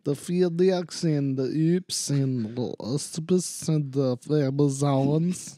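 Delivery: slow list with FedEx as Fee-diks and uspis; hammed-up Russian listing; slow comic Russian list; mock Russian accent. Shown as a demonstration. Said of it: "Now you're there".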